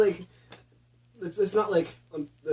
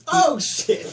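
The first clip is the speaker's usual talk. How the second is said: mock yell; 10 semitones higher, 5 dB louder than usual. Mock quoting the shark line.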